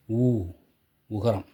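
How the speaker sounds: ordinary delivery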